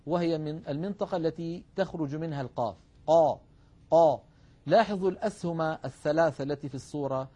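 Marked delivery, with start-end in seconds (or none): 3.1-4.2 قا said loudly with a heavy, raised back tongue; emphatic qaf demo. Shown as a demonstration. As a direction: model the emphatic قا firmly. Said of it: "عندما ننطق القاف لاحظوا". (قا مفخمة بصوت عالٍ)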